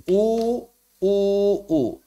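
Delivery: lips pushed forward for u; rounded u. Shown as a demonstration. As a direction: say u with pursed lips. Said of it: "dengan cara mencucu". (u dengan bibir mencucu)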